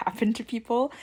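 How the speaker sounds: normal talking voice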